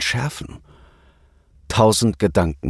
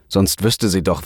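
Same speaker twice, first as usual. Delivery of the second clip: ordinary delivery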